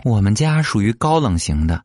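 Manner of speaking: normal talking voice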